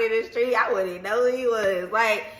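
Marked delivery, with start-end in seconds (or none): none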